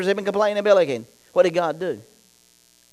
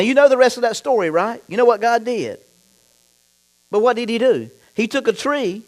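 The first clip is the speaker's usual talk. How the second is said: ordinary delivery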